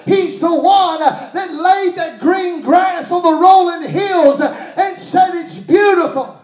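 loud, slow preaching build; fiery sermon delivery; preaching at full force. Acted. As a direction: hammer it home to the church.

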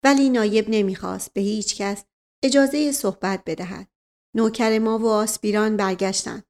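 plain reading voice

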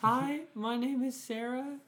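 high-pitched mock girl voice; Sarah. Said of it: "You're doing a weird accent".

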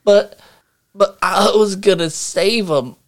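a shot trooper gasping out his regret; dying trooper. Acted. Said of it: "and he says".

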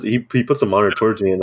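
usual speaking voice

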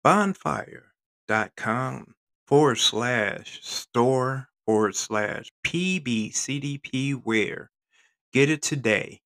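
slow spelled-out ad read. Acted. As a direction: read the web address like an ad. Reads store ad.

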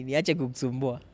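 ordinary talk

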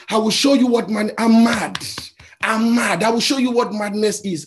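woman yelling at the conductor; furious woman. Retold or imitated. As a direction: threaten him with mock fury.

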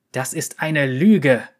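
zornig gezischt, langsam und lauter. A narrator's reading. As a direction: ein wütendes Zischen, gedehnt und laut. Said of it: "zischte Tenef". (wütend und langsam gezischt, lauter als sonst)

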